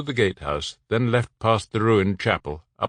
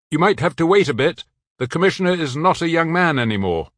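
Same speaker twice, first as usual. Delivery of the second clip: higher, louder, a man calling up the tower; Bruno's voice, urging; 8 semitones higher, 5 dB louder than usual. A narrator's reading. Bruno calling to the man on the tower, loud and higher. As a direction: call up to him, keep him talking.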